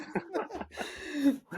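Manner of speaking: a real squeal of a laugh; high laugh. Real, not acted. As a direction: burst of high-pitched laughter. Reacting to funny quote.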